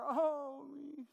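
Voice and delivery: a hammed-up cry of despair, high and loud then falling away; despairing woe. Shown as a demonstration. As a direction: groan it out like a prophet in despair. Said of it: "That woe is a despair".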